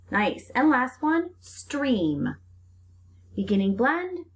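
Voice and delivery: usual speaking voice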